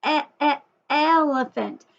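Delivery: "E-, e-, elephant" with the first e split off; sounding out the E. Shown as a demonstration. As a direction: stretch the opening e of "elephant". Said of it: "it starts with the E sound".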